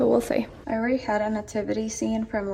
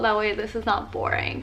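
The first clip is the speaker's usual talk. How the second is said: usual speaking voice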